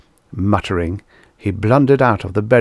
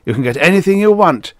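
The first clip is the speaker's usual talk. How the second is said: higher, louder and quick; happy; 9 semitones higher, 5 dB louder, faster than usual. A narrator's reading. General promising Adams whatever he wants, happy. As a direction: assure him gleefully, at a quicker pace. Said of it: "the general told him happily".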